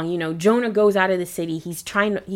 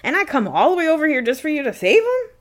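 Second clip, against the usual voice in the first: higher, louder, hammed-up indignation; a prophet griping to God that the sinners got saved, higher and louder; indignant Jonah; 6 semitones higher, 3 dB louder than usual. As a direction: play Jonah outraged at the mercy shown. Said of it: "I guess he's thinking".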